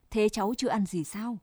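ordinary delivery

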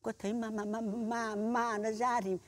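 ordinary delivery

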